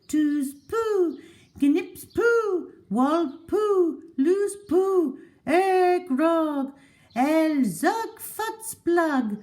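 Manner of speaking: high, chanting singsong spell; high lady's voice chanting nonsense words; Mrs Silver's spell. A narrator's reading. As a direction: recite the magic words like an incantation to the tortoise. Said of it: "she whispered".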